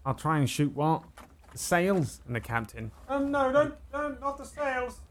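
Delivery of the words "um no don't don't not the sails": high, soft, pleading sailor; timid high voice. Acted. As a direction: nervously beg him not to. GM voices a timid crew member.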